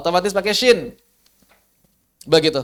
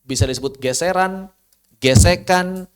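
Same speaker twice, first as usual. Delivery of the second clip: usual speaking voice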